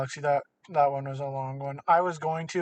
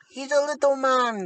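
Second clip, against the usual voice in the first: little man; 8 semitones higher than usual. Acted. Describing small Tinker Knight.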